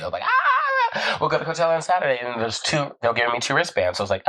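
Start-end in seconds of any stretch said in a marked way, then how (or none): 0.2-2.2 very high squeal, then giddy words; super excited. Retold or imitated. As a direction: squeal with laughing delight, retold. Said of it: "super excited, actually, not just cool beans".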